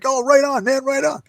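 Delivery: very high, eager mock voice; enthusiastic agreement. Man accepting a lunch invite, high eager agreement.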